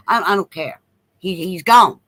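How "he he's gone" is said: usual speaking voice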